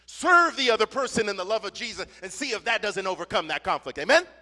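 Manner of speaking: preacher declaring resolve to the church; full-force preaching. Acted. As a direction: preach it with fire.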